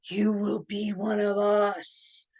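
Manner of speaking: slow, creepy cult chant; mock-sinister, drawn-out invitation; creepy welcome. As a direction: draw it out like an eerie recruiter. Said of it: "creepy, kinda like".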